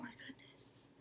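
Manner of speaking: whispered. Real, not acted. Shaken woman whispering after recalling her fear.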